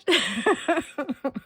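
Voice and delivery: real, easy giggle; amused laugh. Real, not acted. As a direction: laugh off a little mishap. Laughs off lip gloss mishap.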